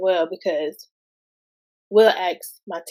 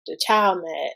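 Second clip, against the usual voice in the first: ordinary talk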